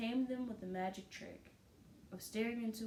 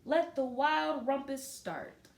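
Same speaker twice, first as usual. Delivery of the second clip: loud, slow, drawn-out announcement; excited proclamation; 6 semitones higher, 9 dB louder, slower than usual. A narrator's reading. Max opening the wild party, loud grand proclamation. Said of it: "cried Max".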